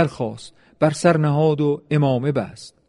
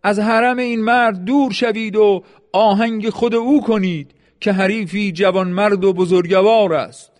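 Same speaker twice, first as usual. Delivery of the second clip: high, raised commanding call; shouting orders; 6 semitones higher than usual. A narrator's reading. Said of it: "فریاد زد". (فرمان بلند و فریادگونه)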